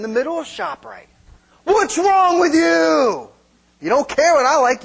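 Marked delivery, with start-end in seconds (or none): 1.6-4.8 hammed-up shout over ice cream; mock angry wife. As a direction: yell it like a wounded wife in the store aisle. Acted ice cream quarrel.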